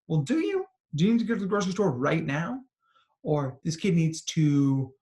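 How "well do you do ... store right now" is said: higher, pointed challenge, quickly asked; sceptical questioning. Acted. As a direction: query it doubtfully, pitch jumping up. Challenging that urgent inner need.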